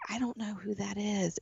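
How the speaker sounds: softly puzzled at a face online; puzzled aside. Retold or imitated. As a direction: mutter it to yourself, baffled. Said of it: "and I'm like".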